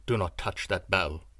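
low, grave sorcerer warning; low looming threat. A narrator's reading. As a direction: warn him low and firm. Sorcerer warns.

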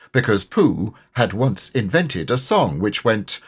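plain reading voice

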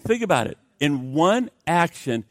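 ordinary talk

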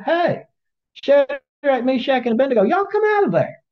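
high voiced king calling into the furnace. The king calling the three men out of the fire, high and amazed. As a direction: call them out up high like an amazed ruler.

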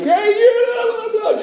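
high mock yell across a pond; imitated screaming. Kids screaming cuss words across the pond, imitated screaming. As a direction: holler it high, as kids calling to each other. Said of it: "screaming across this pond like".

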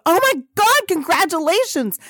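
high, loud gush of delight; excited congratulations. Retold or imitated. Friend hears of a Kardashian interview, excited congratulations. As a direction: squeal it out, thrilled for your friend.

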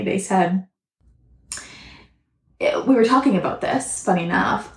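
ordinary talk

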